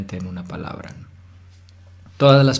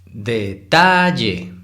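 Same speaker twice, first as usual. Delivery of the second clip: detalle very slow, TA loud and drawn out; exaggerated stressed syllable; 5 dB louder, much slower than usual. Shown as a demonstration. Teacher exaggerating to identify the tonic syllable, exaggerated TA in detalle. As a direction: stretch detalle and lean hard on ta. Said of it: "hay que exagerarlo un poco".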